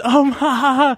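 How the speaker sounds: high, put-on ha ha; mock laughter. Roommate mimicking the room laughing, high mock ha ha.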